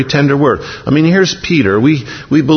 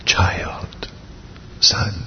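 whispered softly, like a loving father; tender whisper; 10 dB quieter than usual. Pretend. Jesus tenderly whispering to his disciples. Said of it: "I want to hear his voice say that".

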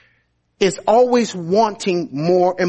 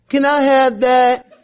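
put-on tiny kid voice, higher and louder; pixie; 6 semitones higher, 4 dB louder than usual. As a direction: squeak it like a little child begging. Child's little voice asks.

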